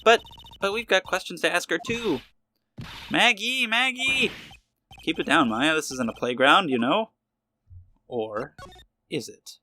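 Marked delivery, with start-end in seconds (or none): 3.1-4.3 calling out. Acted. Calling out to Maggie across room.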